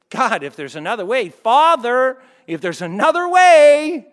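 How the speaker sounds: rising anguished cry; anguished plea. Pretend. Voicing Jesus' anguished prayer.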